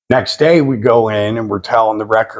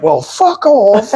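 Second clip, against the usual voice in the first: high, drawn-out mock indignation; put-on high, slow retort; imitated offended girl; 10 semitones higher, slower than usual. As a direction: imitate her high-pitched huffy dismissal. Imitates offended makeup girl storming out.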